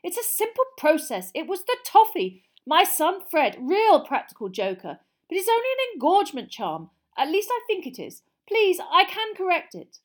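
high, loud, frantic explaining; desperate. A narrator's reading. Mister Weasley explaining the toffee charm to panicked parents, desperately. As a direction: plead to be believed over the uproar. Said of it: "said Mister Weasley desperately".

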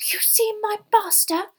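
slow, high breathy elf gasp; breathless squeaky house-elf. A narrator's reading. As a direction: gasp it in high-pitched disbelief. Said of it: "said Winky breathlessly".

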